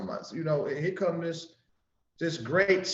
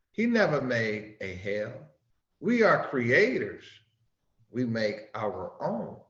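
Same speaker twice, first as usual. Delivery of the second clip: solemn scripture reading; 4 semitones lower than usual. Acted. Opening reading of divine instruction.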